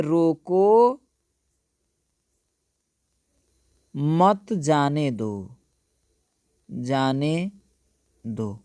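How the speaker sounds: drawn-out रोको, long pause, then slow मत जाने दो; pause after रोको. Shown as a demonstration. Teacher dictating the example to students, slow with pause after रोको. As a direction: spell it out slowly, breaking after रोको and falling low on दो. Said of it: "ये आपका हो गया".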